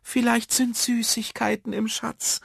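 put-on very high-pitched girl's voice; hopeful little girl. Clara guesses what the treasure holds, hopeful. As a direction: suggest it in a high child's voice. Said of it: "meinte Clara".

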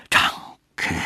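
breathy rustling thanks; rustling whisper. A narrator's reading. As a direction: breathe it like leaves in wind. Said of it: "rauschte der Baum".

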